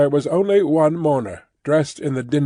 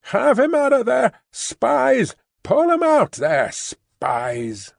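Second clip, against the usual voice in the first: the informant urging the crowd to pull him out, shouting; rabble-rousing shout; 10 semitones higher, slower than usual. Acted. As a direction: whip up the crowd. Informant stirring up the mob.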